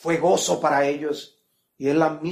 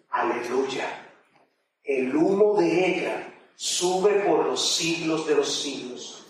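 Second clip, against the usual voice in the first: reverent scripture reading; 3 semitones lower than usual. Acted. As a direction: read the heavenly worship with hushed awe.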